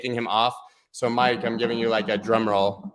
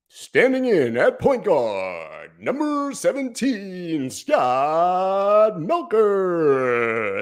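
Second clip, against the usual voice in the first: very slow, stretched player intro, much higher; sports announcer; 6 semitones higher, 3 dB louder, much slower than usual. Pretend. Streamer showing off his announcer voice with his own name, arena announcer. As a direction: hold every syllable like a stadium PA. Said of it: "quit my job here and start announcing, uh, sports events".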